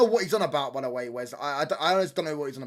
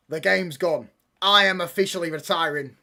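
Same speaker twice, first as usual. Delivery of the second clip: usual speaking voice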